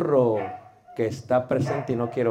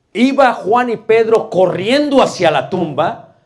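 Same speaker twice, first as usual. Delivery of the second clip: louder, higher storytelling line; raised voice; 6 semitones higher, 9 dB louder than usual. Shown as a demonstration. Teacher narrating the Gospel race to the tomb, raised and louder. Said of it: "fíjate".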